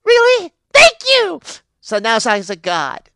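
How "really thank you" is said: very high, loud thanks; hammed-up delighted squeal; thrilled gratitude. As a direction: squeal with joy at the news. Thrilled at becoming a god.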